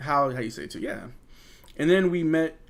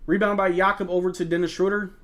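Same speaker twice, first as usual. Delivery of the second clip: ordinary delivery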